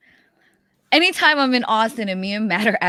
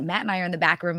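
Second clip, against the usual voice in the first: ordinary talk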